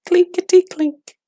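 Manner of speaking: very high, tinny clinking voice; coins rattling. A narrator's reading. Coins rattling in the gravedigger's tin box, high clinking voice. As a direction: ring it out like tiny silver coins. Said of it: "the money went".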